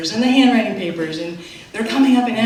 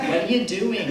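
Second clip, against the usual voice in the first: put-on New York accent. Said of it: "thick New York accent".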